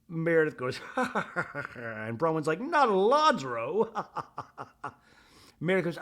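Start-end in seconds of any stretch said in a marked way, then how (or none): 2.6-3.9 high, slow, laughing impression; imitated laughing exclamation; mock amused dismay. Acts Bronwyn reacting with laughter. 3.9-4.9 soft staccato mock laugh; quiet run of put-on chuckles; trailing imitation laugh; imitated laugh. Acts Bronwyn laughing.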